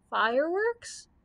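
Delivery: slow, rising, worried question on "Fireworks?"; quavering. A narrator's reading. Scarecrow echoes the Grand Choo Choo, quavering "Fireworks?". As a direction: stretch "Fireworks?" upward in dismay. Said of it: "quavered the Scarecrow".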